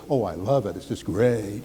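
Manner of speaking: low and soft, dutifully bored; flat, unenthused. Shown as a demonstration. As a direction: say it like you don't mean it. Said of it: "I don't say".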